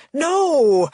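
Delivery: loud, high yelled no; terrified shouted no. Acted. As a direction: yell it in panic. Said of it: "Dad yells".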